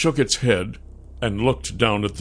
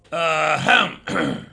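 higher throat-clearing ahem; pointed ahem; 7 semitones higher than usual. Acted. Nath trying to get the gnomes to look up, pointed ahem.

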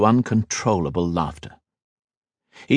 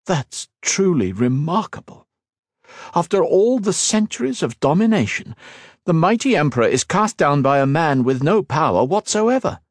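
stunned; 10 semitones higher than usual. A narrator's reading. Stunned cousin marvels at the emperor's fall. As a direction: marvel at the news. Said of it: "Liniel was stunned".

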